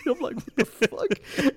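high, laughing "what the fuck", stretched out; laughing disbelief. Real, not acted. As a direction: crack up while asking it. Retelling his reaction, cracking up.

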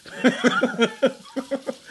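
high burst of laughter; real laughter. Real, not acted. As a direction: crack up helplessly mid-story. Cracks up at the awkward moment.

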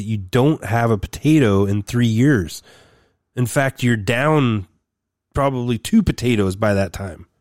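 worked-up friendly debate; heated insistence. Real, not acted. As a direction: hammer the argument home. Heated potato argument with Dario.

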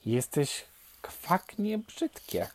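slow, high quacky taunt; quacking ducklings. A narrator's reading. Ducklings tell the odd newcomer it is ugly, quacking taunt. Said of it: "zakwakały kaczuszki".

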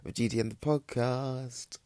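big showbiz podcast intro; announcer voice. Pretend. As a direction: announce it like a host. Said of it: "Yeah, yeah, yeah".

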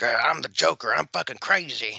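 mock a self-styled crazy online poster; meth head poser. Host mocking people who post Joker boasts, hammed-up poser. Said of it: "those meth heads who".

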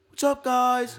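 high-pitched, loud casual greeting; imitated roommate greeting. Storyteller mimicking Frankie lounging in the locked dorm, high-pitched. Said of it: "He's like".

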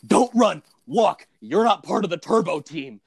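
hammed-up command to keep out; mock stern order. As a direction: pretend to scold an outsider, emphatically. Acting termite crew's stern order.

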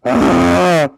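very loud, sustained high cry; an abrupt blast of noise; sudden loud outburst. Real, not acted. Co-host blasts the mic during a prediction, loud outburst. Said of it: "You literally just killed someone".

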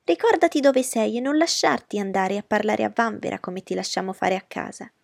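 mother scolding Lizzie in front of guests. Acted. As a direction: rein in a daughter in company. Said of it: "interruppe sua madre".

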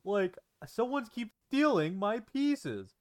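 exasperated mock outrage; retold confused annoyance; what the hell. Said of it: "He's like".

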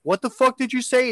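high, indignant mock challenge; angry neighbour. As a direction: imitate an affronted man, pitched high.

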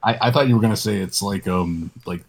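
usual speaking voice

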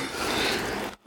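quiet voiceless laugh; real soft, breathy chuckle. Real, not acted. Amused at own theory.

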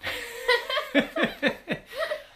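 very high-pitched character laugh; high kangaroo giggle. Acted. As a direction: giggle in a silly, squeaky pitch. Kangaroo greets the children.

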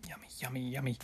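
savoring a sip of wine; just sipped, lips wet; while sipping a drink. Real, not acted. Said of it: "as I drink some".

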